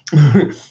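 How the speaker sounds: short, loud laugh. Real, not acted. Vlogger breaking up at his own silly accent, laughing. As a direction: burst out laughing at yourself.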